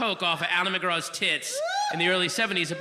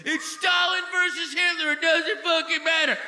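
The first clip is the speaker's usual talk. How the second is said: high mocking voice, dismissive; mock anarchist whine; 8 semitones higher than usual. Comic mocking the anarchists who say voting is pointless. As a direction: sneer it like a smug anarchist.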